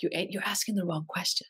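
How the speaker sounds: a hint of the spirit guide speaking; quoting the guide's voice. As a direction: murmur it like the inner guide's voice. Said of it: "it's always this female voice".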